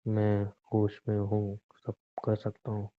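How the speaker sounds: slow, soft and threatening. Acted. Husband coldly ordering his wife to do her duty, menacing. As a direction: say it like a quiet threat.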